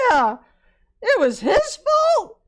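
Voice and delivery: very high, loud cry of disbelief; outraged shout. Retold or imitated. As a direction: shriek it in furious shock. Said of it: "And she goes".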